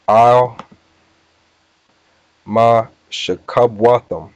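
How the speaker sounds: drawn-out Hebrew words, long pause after each; slow, deliberate recitation. Shown as a demonstration. Teacher giving the psalm in Hebrew, slow and deliberate. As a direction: sound it out slowly, piece by piece. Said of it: "I'm gonna say it slow".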